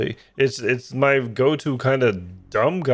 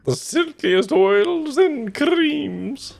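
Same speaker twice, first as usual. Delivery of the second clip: Hedonism Bot; much slower than usual. Retold or imitated. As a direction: savor every word like a decadent aristocrat. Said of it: "Can I do hedonism bot?".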